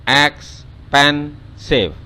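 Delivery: drawn-out teaching demo; syllable by syllable. Shown as a demonstration. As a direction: count out ex-pen-sive slowly on the fingers. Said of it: "yang keluar akhirnya adalah jempol, telunjuk, dan jari tengah".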